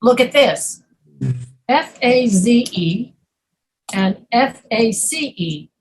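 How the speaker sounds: usual speaking voice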